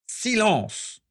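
high-pitched cry for silence; yelled command. A narrator's reading. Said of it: "hurla Abraxas". (cri aigu pour faire taire)

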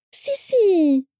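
high, eager, thrilled cry; excited exclamation. A narrator's reading. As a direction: burst out with delight. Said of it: "s'exclama Daisy ... avec enthousiasme".

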